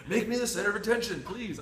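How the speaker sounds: laughing. Real, not acted. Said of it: "laughing at himself".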